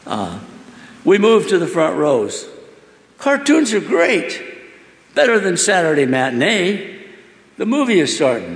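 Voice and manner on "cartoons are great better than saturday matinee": high, slowed delight at the cartoons; thrilled child. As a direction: gush like a kid at his first matinee. Boy thrilled at the movies.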